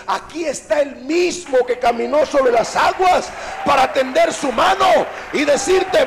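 loud, slow, shouted exhortation; full-force preaching. Real, not acted. Preacher offering rescue to those sinking, loud slow exhortation. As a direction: thunder the promise, stretching each phrase.

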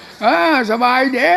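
high, slowed shouted answer with a rise; mock loud reply. Visiting monk answers the supposedly deaf abbot with a shout. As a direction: call back slowly so a deaf man hears. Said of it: "ต้องพูดเสียงดังๆนะ".

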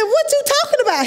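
high-pitched incredulous question; retold alarm. Patient questioning the doctor about recovery time, incredulous. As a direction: demand an explanation in mock alarm.

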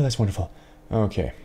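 ordinary talk, nothing marked

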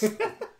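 high burst of laughter. Real, not acted. Laughs at getting-fat joke.

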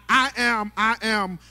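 ordinary delivery

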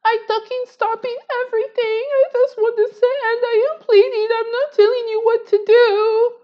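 high-pitched, loud mock plea; shrill pleading Trisha. Imitating Trisha as she begs them to stop, in a high mock squeal. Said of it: "She's like".

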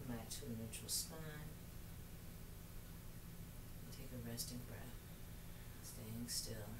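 soft, calm meditation voice; guided meditation. Acted. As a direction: guide them gently into stillness. Leads yoga practice softly.

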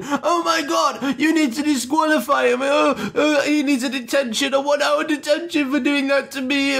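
high-pitched whiny mock outrage; indignant rich kid. Kid hit by a football demands a detention, mock indignant. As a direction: act out a spoilt kid's angry tantrum. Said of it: "he's all, like, up in the air in anger".

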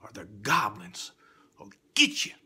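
usual speaking voice

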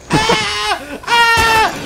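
two very high-pitched screams. Pretend. Player attacked in the game, high scream. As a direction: shriek way up high, twice.

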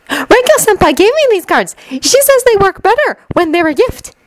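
put-on high-pitched, eager girlish voice; excited. A narrator's reading. Shinobu tells Haruhi about her gifted cards, excitedly. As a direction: share it excitedly, high and keen. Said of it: "turned back to Haruhi excitedly".

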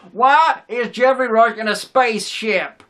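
loud, drawn-out put-on Derry accent, pitched high. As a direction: do an exaggerated, unconvincing Derry accent at full volume. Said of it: "Nobody in Derry has that accent".

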